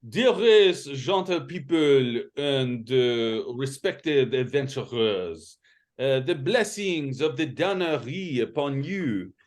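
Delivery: put-on slow, theatrical French accent. Game master reads blue letter to players, in slow French accent. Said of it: "in the mood for a French accent".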